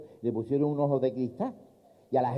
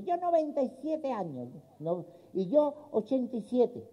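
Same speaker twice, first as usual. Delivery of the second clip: put-on old man voice; 8 semitones higher, 6 dB quieter than usual. Very old couple answering lawyer.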